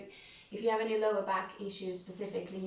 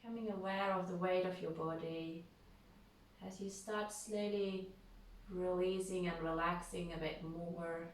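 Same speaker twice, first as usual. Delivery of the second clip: unhurried, calm yoga nidra voice with long pauses; guided meditation. Real, not acted. Leads a guided relaxation.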